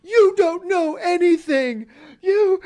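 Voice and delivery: high, loud whiny fan rant; mock angry fan. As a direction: rant like an offended fanboy, slow and loud. Mocking angry fans.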